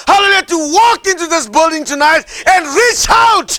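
loud, high-pitched revival preaching; too excited preaching. Real, not acted. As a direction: preach it at full force, carried away with excitement. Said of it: "sometimes I get too excited".

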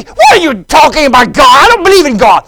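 high, very loud acted protest; shouted retort. Driver disputing that God sent him, shouting. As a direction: yell back in disbelief.

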